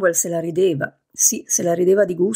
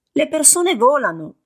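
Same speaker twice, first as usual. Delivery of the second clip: much higher strained squeak; shrill voice; 8 semitones higher than usual. Acted. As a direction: squeeze the words out high. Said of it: "con voce stridula".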